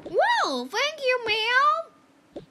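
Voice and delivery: high squealing thanks; excited. Pretend. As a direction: squeal with delight.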